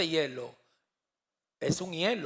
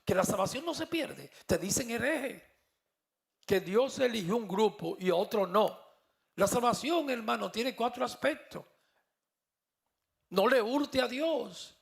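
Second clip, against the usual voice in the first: preaching at full force, pitched higher; anointed preaching voice; 5 semitones higher than usual. Real, not acted. Preacher tells the congregation the Spirit gives him this voice, fervent preaching. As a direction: proclaim it with fire from the pulpit. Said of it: "Hasta el tono de la voz, yo no hablo así".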